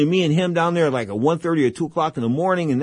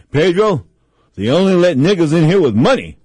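louder, booming punchline; Don King's loud comeback; 3 semitones higher, 7 dB louder than usual. Retold or imitated. Don King booming back at Pedro over a live mic. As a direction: deliver it full-voiced into a hot mic. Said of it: "Don says back to me".

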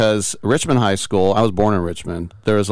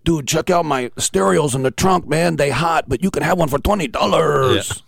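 fake Cheech accent; 7 semitones higher than usual. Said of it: "he would talk like that".

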